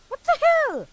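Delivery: high shocked shriek. Real, not acted. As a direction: squeal it in shock. Shot in game, shocked.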